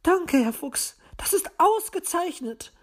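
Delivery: high, gushing magpie thanks; overjoyed bird lady; effusive. A narrator's reading. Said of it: "bedankte sich die Elster überschwänglich".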